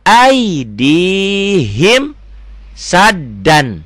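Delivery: slow, drawn-out chanted recitation; Quran recitation. Shown as a demonstration. Teacher recites the verse for beginner students, slow and chanted. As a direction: chant each word slowly and loud.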